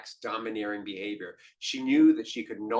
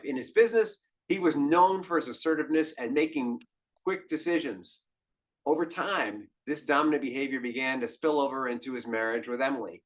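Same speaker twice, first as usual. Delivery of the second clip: ordinary talk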